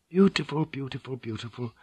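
tender fading repetition; fading repeat. Acted. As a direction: let the word fade to almost nothing. Mother fading praise of son.

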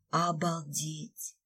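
slow, hushed amazement; awed whisper. Acted. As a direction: breathe it out in wonder. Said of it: "зачарованно прошептала я".

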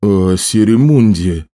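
slow question with a slight waver; faltering voice. A narrator's reading. Port master asking about his own city, voice faltering. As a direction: ask slowly, voice faltering a little. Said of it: "Голос Отто дрогнул".

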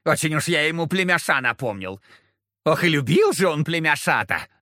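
tipsy, giggling demon voice; giggling devil. Acted. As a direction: snicker it with a hiccup. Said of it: "Чёрт икнул и захихикал".

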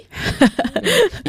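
a short, high, amused laugh; a bright surprised giggle. Real, not acted. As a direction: laugh at being challenged. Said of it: "有点想跟我叫板啊".

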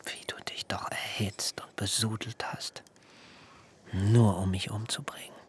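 bitter soft whisper; whispered reproach. Acted. Jakob reproaching Laban in a whisper. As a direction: hiss the accusation quietly.

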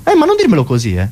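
high-pitched mock indignation; hammed-up playground tough looking for a quarrel; middle-school bully. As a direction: take offence and square up. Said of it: "è un coatto delle medie".

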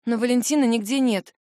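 ordinary delivery, nothing marked

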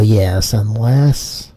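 usual speaking voice

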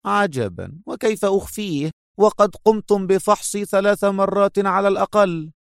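high-pitched mocking woman's voice; sarcastic. A narrator's reading. Captive woman scoffing at her captor's accusation, sarcastic. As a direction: lilt it high with amused scorn. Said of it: "ابتسمت قائلة في سخرية".

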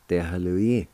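ordinary delivery

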